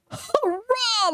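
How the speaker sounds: high, laughing cheer. A narrator's reading. Thomas cheering as he shoves his driver off, laughing cheer. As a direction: whoop it out with a laugh. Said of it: "laughed Thomas".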